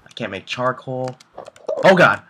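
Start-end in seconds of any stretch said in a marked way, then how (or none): 1.8-2.2 sudden high, loud shriek of fright; a real scared yell at a monster; startled yelp. Real, not acted. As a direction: jump out of your skin. Startled by a mob.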